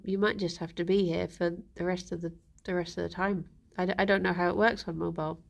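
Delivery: usual speaking voice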